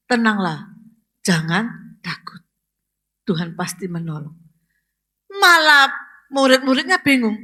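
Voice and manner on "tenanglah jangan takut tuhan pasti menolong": God's reassurance. Acted. Preacher quietly voicing God comforting the congregation. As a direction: soothe them gently, as God comforting the frightened. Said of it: "Dan Tuhan tolong kita semua".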